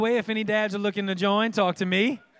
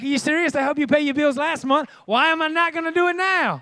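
high, raised-voice rebuke; incredulous exasperation; 7 semitones higher, 5 dB louder than usual. Acted. God answering doubters about provision, incredulous and worked up. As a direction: build to an indignant question.

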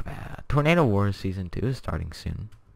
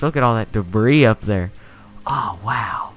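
ordinary talk, nothing marked

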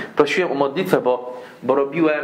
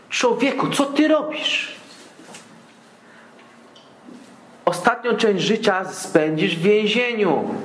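higher, slow, pleading voice; moved prosecutor; 6 semitones higher, slower than usual. Retold or imitated. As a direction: plead with him as if near tears, slowly. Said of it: "miał prawie łzy w oczach".

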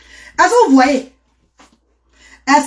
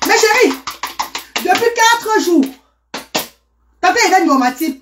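excited; 8 semitones higher than usual. Real, not acted. Excited claim to viewers.

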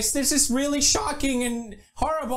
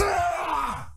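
ordinary talk

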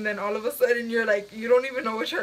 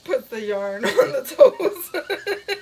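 laughing; 5 dB louder, much slower than usual. Real, not acted. Laughing fit.

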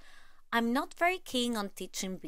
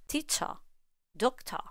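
British "Teacher, doctor" ending in a plain schwa, no R; British English; 3 semitones higher, 3 dB quieter than usual. Shown as a demonstration. Teacher showing the schwa endings, British English accent. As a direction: drop the final R in "Teacher, doctor", British style. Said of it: "in British English".

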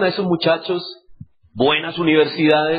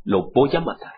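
quiet threat; 5 semitones lower, 3 dB quieter than usual. Retold or imitated. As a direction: mutter the threat coldly. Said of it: "y dijo".

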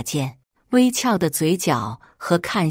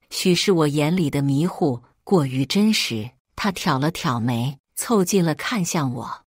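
usual speaking voice

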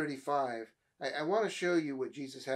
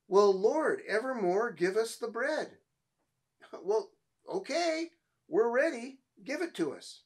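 pitched-up, eager plea for bread with a laugh; eager crowd; 7 semitones higher, 4 dB louder than usual. Retold or imitated. Preacher voicing the crowd begging Jesus for bread, eager and laughing. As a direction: play the crowd hungry and pushy.

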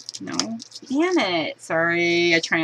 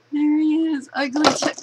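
excited reveal; 8 semitones higher, 3 dB louder than usual. Real, not acted. Revealing the doll.

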